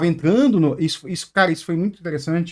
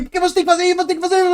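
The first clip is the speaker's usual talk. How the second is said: high, loud mimicking of a demanding caller; mock the pushy caller, pitched way up; high mocking voice of a demanding coworker; 12 semitones higher, 4 dB louder, much faster than usual. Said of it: "E aí".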